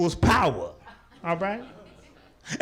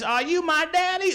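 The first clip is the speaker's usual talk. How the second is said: put-on child voice; 9 semitones higher than usual. Child's message voiced in bit.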